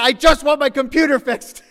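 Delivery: high, loud mock-exasperated whine; exasperated punchline. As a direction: deliver the joke as fed-up innocence. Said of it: "And I said".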